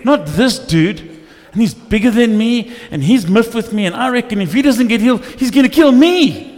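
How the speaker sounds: mock-panicked prayer building to a high, loud, laughing wail; panicked plea. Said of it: "I'm like".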